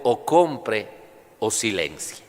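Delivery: normal talking voice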